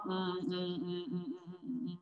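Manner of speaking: quiet drawn-out "mm" hums; soft humming. Real, not acted. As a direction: hum softly while deciding. Teacher hums while picking a student. (bergumam pelan "mm")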